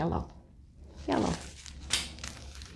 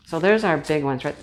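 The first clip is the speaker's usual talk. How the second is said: ordinary talk, nothing marked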